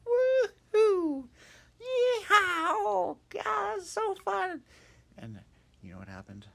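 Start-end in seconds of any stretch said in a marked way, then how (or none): 0.0-4.6 high, loud whoops of glee; joyful whooping. Acted. Hop whooping with joy riding the vacuum cleaner.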